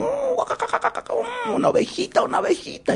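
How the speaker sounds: very high squeaky fox, whispery with greed; a hungry fox eyeing a lamb; greedy fox voice. Acted. Fox spotting a lamb, high greedy voice.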